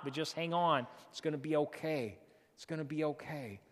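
low, softened comforting voice; gentle reassurance. Real, not acted. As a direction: settle down and soothe the listeners. Preacher reassures the congregation.

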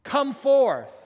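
slow, loud, high call; booming drawn-out summons; Jesus summoning Lazarus. Acted. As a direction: call the dead out with a pause between. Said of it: "and he spoke".

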